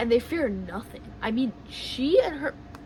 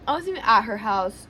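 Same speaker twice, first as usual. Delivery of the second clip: normal talking voice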